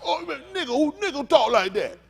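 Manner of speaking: high, loud disbelieving outburst; hammed-up astonished objection; mock incredulity. Acting out a disbelieving reaction.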